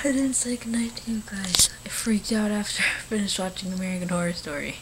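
hushed whisper. Real, not acted. Vlogger whispers to camera late at night.

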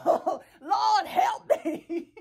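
mock overwhelmed cry. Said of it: "you be like".

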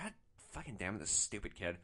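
low, quiet grumble; mildly annoyed. Retold or imitated. Driver looking at the puke scene, quietly annoyed. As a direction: curse him softly under your breath. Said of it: "kind of annoyed".

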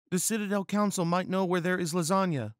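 high, quick female character voice; serious Miranda. Acted. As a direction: advise earnestly in a high-pitched put-on voice. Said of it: "said Miranda to Garfield in a serious tone".

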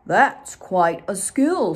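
big slow bear admiring a skill. Acted. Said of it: "said Bear".

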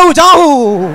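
hammed-up chronic stammer; comic stammerer fighting for the word. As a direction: get stuck on "Cha" and blurt a hooting "who". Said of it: "The first stammerer".

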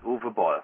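Uwe as OO-vuh, Boll as bawl; Uwe Boll pronounced. Shown as a demonstration. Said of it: "you pronounce it Uwe Boll".

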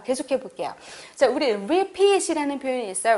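ordinary talk

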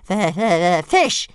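high, loud, stammering dragon voice; hissing little dragon. A narrator's reading. Toothless demands food from Hiccup, shrill stammering dragon voice. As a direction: squeal it like a hungry baby dragon. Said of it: "hissed Toothless".

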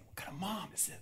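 very soft, near-whispered aside; barely audible muttered remark; hushed aside. Real, not acted. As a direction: drop to a whisper for a private comment. Scandalized aside about the mother.

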